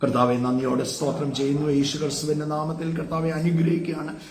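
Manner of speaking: rising, urgent preacher's prayer, building in pitch; fervent intercession prayer. Acted. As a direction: pray over the congregation with growing fervour.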